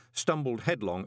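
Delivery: usual speaking voice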